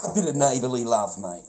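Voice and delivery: giggly neighbourly love; laughing delight. Real, not acted. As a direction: toss it out gleefully through a laugh. Amused, repeats phrase.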